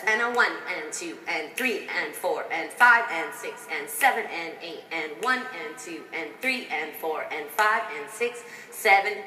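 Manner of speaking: rhythmic counting to the beat; dance count. Shown as a demonstration. Choreographer counting dancers through the steps. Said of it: "Marissa's gonna start out with her feet".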